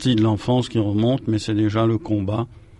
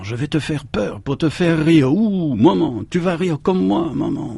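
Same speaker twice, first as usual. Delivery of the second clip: put-on playful child scare; 6 semitones higher than usual.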